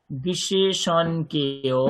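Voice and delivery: বিশেষণকেও stretched out very slowly; slow deliberate teacher emphasis; slow emphatic word. Shown as a demonstration. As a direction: drag out বিশেষণকেও syllable by syllable to stress it. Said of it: "teacher stressing key word".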